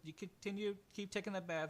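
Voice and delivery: high put-on woman's voice; Jenny instructing. Woman telling the man to keep bathing, high put-on voice. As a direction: tell them to carry on in a high voice. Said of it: "she goes".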